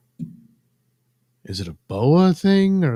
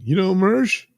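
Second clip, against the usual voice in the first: higher, louder mock street shout; calling out; 10 semitones higher, 5 dB louder than usual. Crazy guy asking random people on the street, mock calling out. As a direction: call it out to passers-by, pitched up. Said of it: "calling out to random people on the street".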